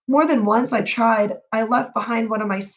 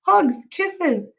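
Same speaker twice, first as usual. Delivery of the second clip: higher, louder happy cry; joyful shout; 9 semitones higher, 4 dB louder, much slower than usual. Acted. Parents shouting with joy that the statue is alive. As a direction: burst out with joy. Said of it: "they shouted".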